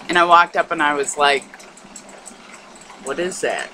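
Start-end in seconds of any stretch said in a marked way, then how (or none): 3.0-3.7 low, softer puzzled question; puzzled reaction. Retold or imitated. Recalling walking up to a mirror, puzzled. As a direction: ask it quickly and low, mildly baffled. Retells first startled look, first take.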